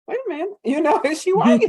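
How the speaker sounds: high, surprised pitch rising into a laugh; a stranger doing a double take at the light-skinned sister; mock double take. Surprised double take, voice jumping high.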